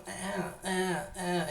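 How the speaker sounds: drawn-out open "ah" vowel sound; open English vowel. Shown as a demonstration. Teacher models the English vowel color for students. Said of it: "Traten de sonar un poquito así como".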